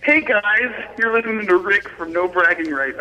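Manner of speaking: hyped-up radio shout-out; bright announcer read for the DJ; radio drop. Shown as a demonstration. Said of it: "Are you ready?".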